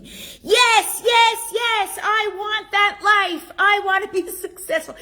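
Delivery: high, loud hammed-up coaching-webinar yell; mock screaming crowd. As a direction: parody fans shouting yes, yes. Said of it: "they scream".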